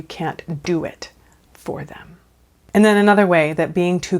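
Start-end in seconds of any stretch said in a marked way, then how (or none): 0.0-2.2 whispered, unvoiced, for emphasis. Real, not acted. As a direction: whisper the key point. Drops to whisper for the point.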